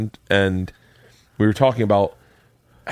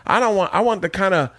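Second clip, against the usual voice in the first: lightly voiced stand-up riff, pitched higher; Ralphie throwaway impression; 8 semitones higher than usual. Podcaster quotes comedian's jokes said in passing, high throwaway riff. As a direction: toss the joke off in a raised comic voice. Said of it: "he would say things that in passing".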